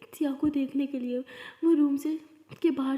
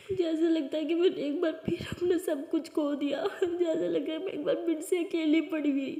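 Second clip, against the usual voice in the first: tearful, broken voice; crying; 5 semitones higher than usual. Acted. Heartbroken daughter telling her mother she feels alone, crying. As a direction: speak through tears.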